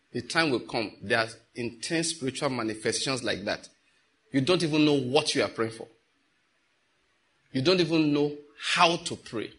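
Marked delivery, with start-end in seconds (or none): none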